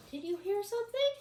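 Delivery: high anxious bug question. Acted. The bug wonders about a noise, high and nervous. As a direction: ask nervously as a tiny bug. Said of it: "asked the smelly little bug".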